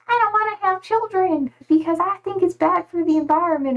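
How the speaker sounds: mocking quote. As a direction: lightly mimic a preachy view. Mock quoting antinatalist view.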